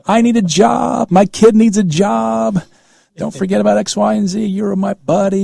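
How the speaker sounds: a hammed-up needy supporter begging not to be forgotten; mock pleading favour-seeker. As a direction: beg like someone cashing in a favour.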